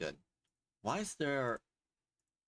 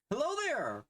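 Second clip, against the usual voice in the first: high, sing-song hello; bright high greeting; 11 semitones higher than usual. Real, not acted. Greeting viewers, YouTuber intro.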